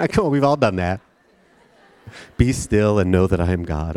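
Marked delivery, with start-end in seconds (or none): 2.4-4.0 low, hushed-pitch mock reverence; mock solemn. Posting a Bible verse on Instagram, mock solemn. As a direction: intone it like a pious caption.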